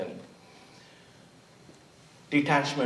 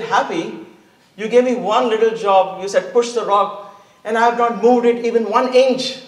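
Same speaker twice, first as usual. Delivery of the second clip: higher and louder, exasperated; frustrated outburst; 8 semitones higher, 8 dB louder than usual. Acted. Discouraged man protesting to God about the rock, exasperated shout. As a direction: let the frustration burst out.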